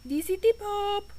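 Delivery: high put-on Pope voice, pitch way up. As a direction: play the Pope picking up the phone, sung high. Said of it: "He'd be like".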